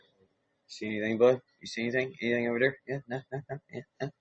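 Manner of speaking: softly acted questioning, lightly voiced; hushed lookout. Dog scouting for its buddy over there, hushed mock questioning. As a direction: play a quiet lookout checking with a buddy.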